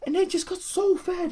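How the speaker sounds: bursting with glee over his picks; excited. Real, not acted.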